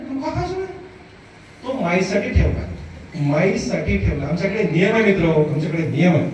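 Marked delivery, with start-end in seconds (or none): 0.1-0.7 high, softer mock protest; protesting husband. Husband asks wife why coconut is forbidden, high mock protest. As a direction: act out a puzzled, high-pitched objection. Acts husband questioning the ban.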